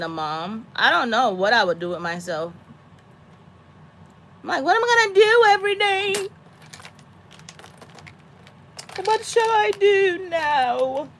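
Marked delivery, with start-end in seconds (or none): 4.6-11.1 theatrical wailing lament; an emotional wreck. Acted. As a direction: ham up being lost and helpless. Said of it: "gonna be a wreck".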